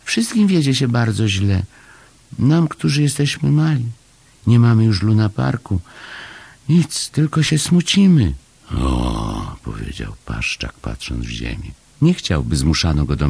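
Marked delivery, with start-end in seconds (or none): 0.0-8.3 high, plaintive small voice; sad little Chomek. A narrator's reading. Chomek telling Paszczak of the little ones' sorrow, high plaintive voice. 8.7-9.6 low, understated "oh"; low awkward Paszczak. A narrator's reading. Paszczak avoiding sad thoughts, low reluctant acknowledgement. Paszczak downcast, looking at the ground.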